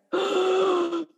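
sharp gasp through a breathing mask. Retold or imitated. Demonstrating oxygen mask breathing.

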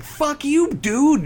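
high-pitched mock yell; exaggerated high taunt. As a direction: throw it out as a high, cheeky jeer. Acts out the film's attitude.

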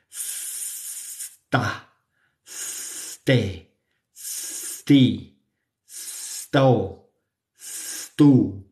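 sta ste sti sto stu, each begun on a clean s; syllable drill. Shown as a demonstration. Teacher drilling s plus t syllables. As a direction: drill the s clusters slowly. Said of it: "solo estoy jugando con los fonemas".

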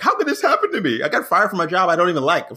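high, self-pitying mock outrage; mock indignant. Guest mocking his entitled past self, high mock outrage. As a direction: whine at the injustice of it.

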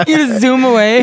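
laughing. Real, not acted. Laughing at the Heelys joke.

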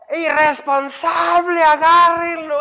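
high, loud, drawn-out outraged yell; mother making a scene. Retold or imitated. Pastor imitates the mother screaming at the driver, high hammed-up yell. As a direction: put on a big dramatic scene, shrieking blame. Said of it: "hizo el show así".

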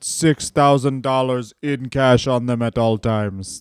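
put-on accented old champion laying down rules; earnest impression of an old wrestler; Bruno Sammartino. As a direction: pronounce it solemnly in a put-on accent. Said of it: "there, you're getting close now".

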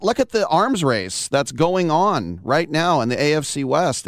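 ordinary delivery

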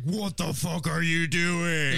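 high, drawn-out mock yell; mock outrage. Friends react to a bad Magic move, mock outrage. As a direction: yell it in exaggerated disbelief, stretching the words.

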